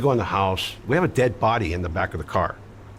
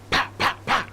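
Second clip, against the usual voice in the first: voiced slaps, pa pa pa; slap sounds. Friend slapping the dead man's head, imitated slap noises. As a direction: mimic the slaps with your mouth. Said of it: "he's slapping him in the head".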